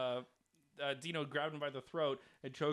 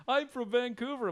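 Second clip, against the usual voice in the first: high, louder mock insistence; raised-pitch mock call-out; imitated heckler; 10 semitones higher, 5 dB louder than usual.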